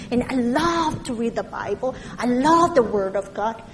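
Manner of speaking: usual speaking voice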